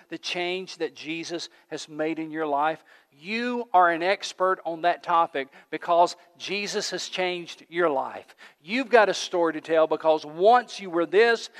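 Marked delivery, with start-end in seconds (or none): 3.1-8.3 each word weighed, slow; slow right down to land it; slow, weighty preaching; slowed emphasis. Real, not acted. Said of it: "preacher slows to emphasize".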